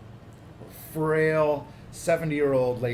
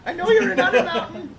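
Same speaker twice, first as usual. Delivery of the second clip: put-on old lady voice; 10 dB louder than usual. Same old lady voice continues.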